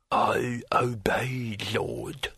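slow breathless whisper from inside the head; whispery telepathic voice. Acted. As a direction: whisper it like a voice in the mind. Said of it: "said a voice in his head".

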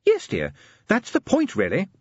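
high, gently amused lady; a patient, teasing wife; genteel lady voice. Acted. Sybil speaks.

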